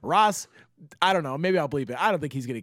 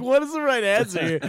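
laughing panic; 8 semitones higher, 4 dB louder than usual. Real, not acted. Laughing panic at cop's question.

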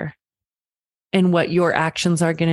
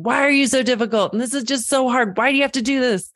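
higher, pleading, at the end of your rope; mock exasperated parent; 7 semitones higher, faster than usual. Exhausted parent snapping at a difficult child, mock exasperated. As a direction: play the worn-out parent losing it. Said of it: "bursting of just overwhelm and screaming at your child and saying".

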